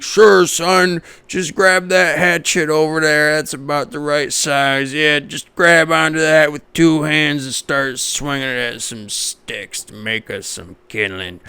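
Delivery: a hint of an easygoing father handing over a hatchet; dad cheerfully answering his little son, lightly voiced; mock dad voice. Mock dad reply to kid.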